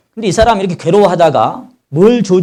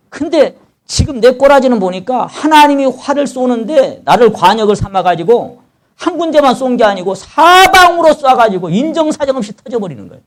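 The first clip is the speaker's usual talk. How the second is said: high, forceful anguished sermon delivery; impassioned preaching; 11 semitones higher, 6 dB louder than usual. Acted. Preacher voicing Job's complaint against God, high and impassioned. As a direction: preach the sufferer's complaint with raised, urgent pitch.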